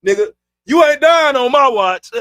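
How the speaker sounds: mock-aggressive shout, on the edge of laughter; aggressive cousin. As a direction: act out a forceful refusal, loud. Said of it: "Cuz was aggressive as hell".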